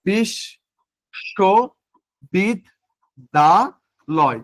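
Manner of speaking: বিশ then শোবিদ্যালয় stretched out very slowly, syllable by syllable; very slow syllable demo of বিশ শোবিদ্যালয়. Shown as a demonstration. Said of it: "কিভাবে উচ্চারণ করা যায় এটা".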